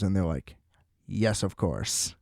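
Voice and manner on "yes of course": low, soft, slow agreement; hushed, unhurried mock courtesy; calm mock politeness. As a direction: imitate an absurdly placid, obliging cop. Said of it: "like, a very calm".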